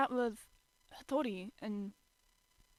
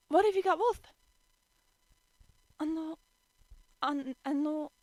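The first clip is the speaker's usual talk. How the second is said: high, loud fretting; worried girl; 7 semitones higher, 6 dB louder than usual. A narrator's reading. Toru worrying Yuki gets worse, high anxious voice. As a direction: fret and stumble.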